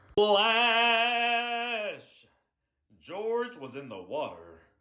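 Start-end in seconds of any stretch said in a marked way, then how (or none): 0.1-1.6 high, long-held splash; voiced splash effect. A narrator's reading. George drops into the dunk tank, drawn-out splash. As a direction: stretch the word out like the fall itself.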